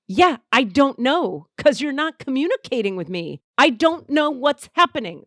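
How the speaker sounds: loud, high exasperated protest; retold frustrated outburst. Arguing back at him over the phone, frustrated. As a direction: argue back hard, punching it out.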